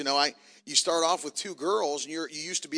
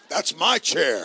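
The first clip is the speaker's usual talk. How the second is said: put-on loud, bossy little boy claiming his seat; mock toddler demand; 8 dB louder, slower than usual. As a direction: bark it like a tiny tyrant. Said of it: "It's like".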